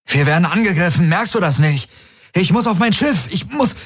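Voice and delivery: high, hurried breathless protest; climbing pitch, breaking off; panting urgency. A narrator's reading. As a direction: plead to get past while running. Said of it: "keuchte Garland".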